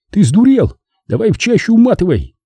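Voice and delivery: high-pitched, incredulous urgency; agitated comrade, voice jumping up; alarmed urging. A narrator's reading. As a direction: urge him frantically to get away. Wounded comrade urges him to flee.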